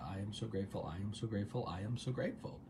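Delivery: soft, low, evenly repeated; hushed run of affirmations; stated mantra. Shown as a demonstration. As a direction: recite it quietly, as if to yourself. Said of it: "is just stating our mantra".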